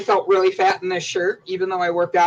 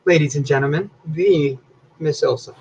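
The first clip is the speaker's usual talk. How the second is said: announcer introduction; 3 semitones lower than usual. Acted. Host introducing co-host.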